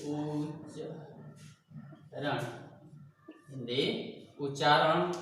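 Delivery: slow, low words with long gaps; writing along. Real, not acted. Teacher writes the rule for students, speaking in slow, broken-up dictation. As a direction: say it piece by piece as you write. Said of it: "लिख रहा हूँ मैं".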